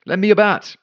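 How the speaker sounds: high, loud call across the field; hollered request. Acted. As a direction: holler it out boldly to the batter. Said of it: "she hollered".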